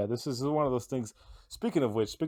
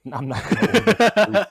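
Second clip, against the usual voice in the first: higher, louder indignant refusal; 9 semitones higher, 12 dB louder than usual. Real, not acted. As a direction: shout down the silly idea. Rejecting soup suggestion.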